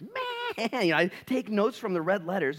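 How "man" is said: very high, drawn-out exasperated sigh word; exasperated man. Retold or imitated. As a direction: stretch it up high in disbelief. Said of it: "And I quote Jesus".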